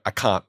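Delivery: "I can't" with the final T left off; dropped T. Shown as a demonstration. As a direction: say "can't" without touching the T. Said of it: "I'd say things like".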